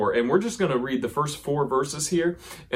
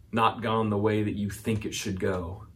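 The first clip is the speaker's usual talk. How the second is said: normal talking voice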